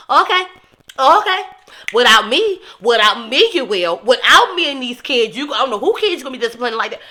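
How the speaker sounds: loud, high, furious retort; outraged shout. Real, not acted. Yelling at the abusive husband on screen, outraged. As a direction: shout it back at him in disbelief.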